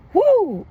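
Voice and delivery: high, loud whoo of disbelief; loud exasperated whoo. Real, not acted. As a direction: blow out a big whoo. Overwhelmed recalling the attacks.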